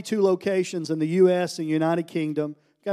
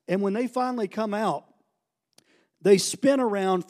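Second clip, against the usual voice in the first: usual speaking voice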